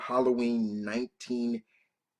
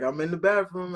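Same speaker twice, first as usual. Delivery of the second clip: lightly voiced mom, higher; a hint of mom calling out; mother's voice; 7 semitones higher, 3 dB louder than usual. Mother answering her son from the bathroom, higher. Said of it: "she's like".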